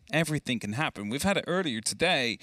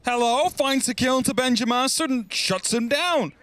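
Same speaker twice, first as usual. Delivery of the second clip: higher, louder excited calling; excited play-by-play; 11 semitones higher, 4 dB louder than usual. Real, not acted. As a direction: call the action with rising hype. Live play-by-play of a kill.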